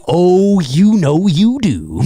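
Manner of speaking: loud, slow, drawn-out taunt; hammed-up knowing tease; gloating. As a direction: stretch it out like a gloating villain. Gloating at audience before cackle.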